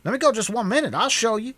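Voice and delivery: very high, loud frantic plea; wailing. Acted. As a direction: cry out to be let loose. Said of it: "the other wailed".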